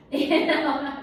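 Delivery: laughing. Real, not acted. Pilates teacher laughs about cutting out sugar, laughing. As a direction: say it slowly while laughing. Laughs while cueing the breath.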